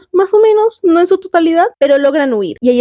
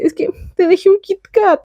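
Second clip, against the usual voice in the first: high, put-on little voice; mock-pleading quote; 7 semitones higher than usual. Will making up with Nico over a Kit Kat, high mock-pleading voice. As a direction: imitate a sheepish peace offering, pitched up. Acts out silly end of quarrel.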